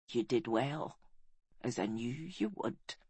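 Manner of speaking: low, softened praise from failing lungs; faint, low reassurance; weak dying voice. A narrator's reading. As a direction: speak softly and low, strength going. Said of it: "She coughed weakly".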